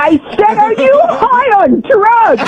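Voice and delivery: high-pitched, drawn-out angry question; indignant and at the end of patience; exasperated demand. Real, not acted. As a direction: spell it out slowly, voice climbing in outrage. Angry caller repeats her question.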